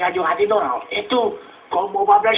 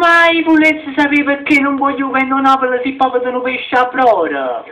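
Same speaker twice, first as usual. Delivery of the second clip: indignant shouting; 5 semitones higher, 4 dB louder than usual. Acted. Heated argument continues.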